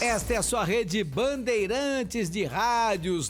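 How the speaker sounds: booming network announcer voice; radio announcer. Acted. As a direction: announce the network with big flourish.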